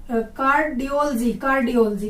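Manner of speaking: कार्डियोलॉजी as car-di-OL-jee; corrected pronunciation. Shown as a demonstration. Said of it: "इसको बोलते हैं". (कार्डियोलॉजी को कार्डियोल-जी बोलकर)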